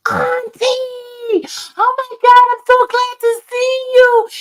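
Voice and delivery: very high, squealed mock-crying reunion; tearful glad greeting. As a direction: imitate an overjoyed, crying squeal, drawn out and loud. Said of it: "She started crying".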